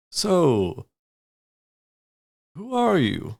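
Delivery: high, drawn-out questioning voice; high-pitched half-drow sizing someone up; half-drow character. Acted. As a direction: ask it slowly, pitched well up.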